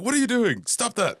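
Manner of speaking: high-pitched exasperated scolding; mock God telling someone off, voice jumping up. Voicing an irritated God.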